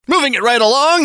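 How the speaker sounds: usual speaking voice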